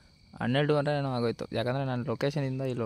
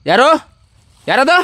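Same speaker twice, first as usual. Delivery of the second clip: sharp frightened yell, much higher; startled shout; 12 semitones higher, 18 dB louder than usual. Real, not acted. Alone in the dark, he spots a figure and yells in fright. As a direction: jolt out a scared demand.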